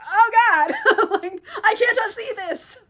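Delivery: high, loud, laughing through the words; laughing horror. Retold or imitated. James unable to unsee the carnage, loud laughing horror. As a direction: cackle while faking disgust.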